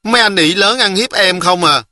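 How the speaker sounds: put-on high, wounded little-girl whine; close to tears. A narrator's reading. Girl accuses older boys of bullying her, mildly tearful. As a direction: accuse them with a touch of tears. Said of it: "Nhỏ Hường Lý nói giọng muốn khóc".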